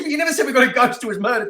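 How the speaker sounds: high, fast, loud acted objection; incredulous retold protest. Storyteller arguing back at his friend about the ghost, incredulous. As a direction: rush out a mock-indignant rebuttal.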